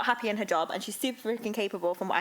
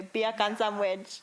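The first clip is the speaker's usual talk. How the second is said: slow Jamaican-style "Beer can sandwich"; drawn out in a mock Jamaican accent; much slower than usual. Shown as a demonstration. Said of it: "No, you go".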